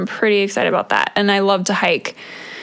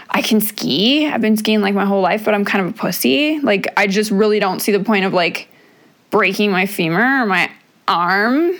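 usual speaking voice

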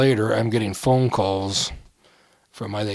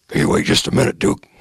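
gravelly wrestler voice, a bit louder; Dick the Bruiser impression; 5 semitones lower, 5 dB louder than usual. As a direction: growl it out like a gruff old wrestler. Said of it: "That's a good impression".

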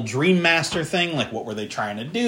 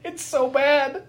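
high voice full of real amusement; cracked up; 4 dB louder than usual. Real, not acted. Host cracked up by the cheesy souls line, high and laughing. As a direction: laugh into it, then slow down on the verdict. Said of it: "Which cracked me the fuck up".

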